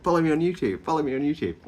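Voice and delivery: high-pitched mock self-promotion; eager plugging. Vlogger quoting herself to strangers on a train, high eager plug.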